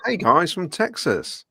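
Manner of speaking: put-on a failed stab at a Texas accent; bad Texas accent. Reading a fan's greeting in a bad Texas accent. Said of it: "That's not a Texas accent".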